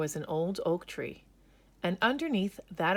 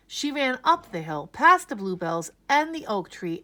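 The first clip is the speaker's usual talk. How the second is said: loud, higher, breathless telling; louder, higher building excitement; urgent chase narration; 3 semitones higher, 8 dB louder than usual. A narrator's reading. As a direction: race through the escape urgently. Bunny flees in fear.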